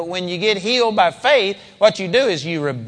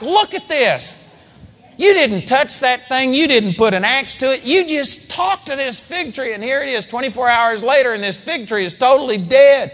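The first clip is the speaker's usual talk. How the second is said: higher, amazed telling; shocked; 6 semitones higher than usual. Shown as a demonstration. Peter marvels to Jesus, amazed. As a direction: marvel at the dead tree. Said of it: "He was shocked".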